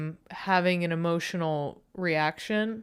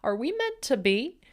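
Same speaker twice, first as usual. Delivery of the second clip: higher, lovestruck question; mock smitten; 6 semitones higher than usual. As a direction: swoon as if meeting a soulmate. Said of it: "I was like".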